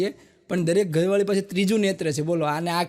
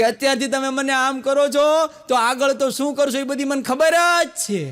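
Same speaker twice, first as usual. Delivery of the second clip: scolding wife; 5 semitones higher than usual. Retold or imitated. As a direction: let the wife's complaint swell and stretch out. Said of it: "તરત તમને કહે છે કે".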